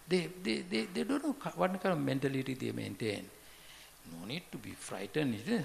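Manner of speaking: ordinary talk, nothing marked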